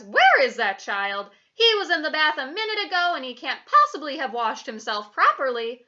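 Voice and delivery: loud, put-out motherly fussing; exasperated mother. A narrator's reading. Mummy cannot see her child in the bath, exasperated. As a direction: fuss loudly over a missing child.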